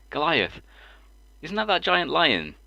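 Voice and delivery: high, drawn-out baffled question; puzzled mate. Retold or imitated. Retelling a colleague's mix-up, puzzled. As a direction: quote a mate's earnest confusion.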